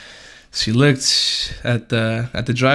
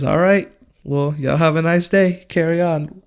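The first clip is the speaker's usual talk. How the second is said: cop dismissing; 6 semitones higher than usual. Retold or imitated. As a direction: wave them off like the cop, pitched up. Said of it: "she says".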